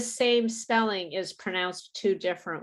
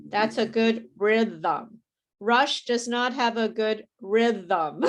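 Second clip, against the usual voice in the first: slow model sentence; slower than usual. Shown as a demonstration. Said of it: "You have to rhythm".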